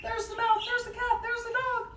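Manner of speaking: put-on high-pitched squealing kid; high, breathless mock panic; startled child. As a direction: cry out like a child jolted awake. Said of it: "the child's like".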